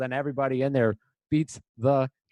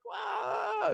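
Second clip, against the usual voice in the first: very high, breathy mock wow; mock amazed wow; 7 dB quieter than usual. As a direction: gasp it out in fake awe. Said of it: "I'm like".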